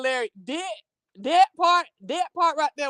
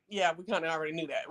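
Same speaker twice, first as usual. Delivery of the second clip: deadpan everyone; 8 semitones lower, 11 dB quieter, faster than usual. Retold or imitated. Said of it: "everybody's like".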